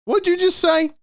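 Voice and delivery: can't believe your ears; outraged disbelief at the player; incredulous. Real, not acted. Reacting to the player's quote.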